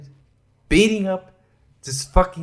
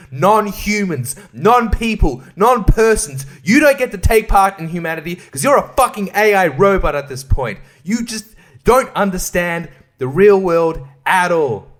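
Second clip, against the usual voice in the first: louder, higher heated rant; 5 semitones higher, 7 dB louder than usual. Real, not acted. Berating Will Wheaton as a Hollywood robot, heated and loud. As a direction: rail against Hollywood people.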